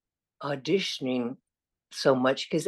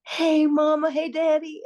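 Southern dialect; 13 semitones higher, 4 dB louder than usual. Retold or imitated. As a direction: sing it out high in a broad Southern accent. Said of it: "doing my Southern dialect for you".

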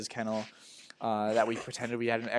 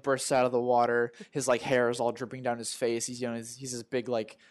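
normal talking voice

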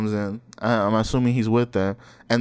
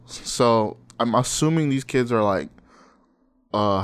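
normal talking voice

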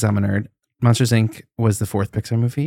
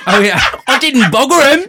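imitating Gina Gershon; 12 semitones higher, 4 dB louder than usual. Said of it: "Gina Gershon's like".

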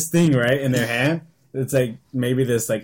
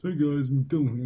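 McDillon voice; 3 dB quieter than usual. Pretend. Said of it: "McDillon's voice".

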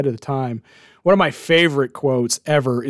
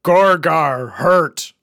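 slow pinball robot voice; much slower than usual. Retold or imitated. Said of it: "it only said seven words".

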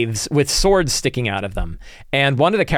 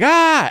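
short high mock scream; a sharp hammed-up shriek from the dark; retold scream; 4 dB louder than usual. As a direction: yelp it out high, like a sudden fright. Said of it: "he starts screaming".

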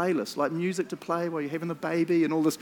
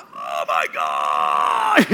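labour groan; 12 semitones lower, 5 dB louder, much slower than usual. Retold or imitated. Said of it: "doing the".

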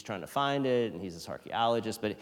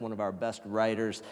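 ordinary talk

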